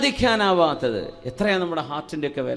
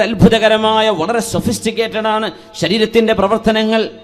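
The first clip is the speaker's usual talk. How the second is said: loud, high-pitched sermon delivery; impassioned preaching; 4 semitones higher, 7 dB louder than usual. Real, not acted. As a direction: preach it out in ringing, sustained phrases. Preaches to congregation.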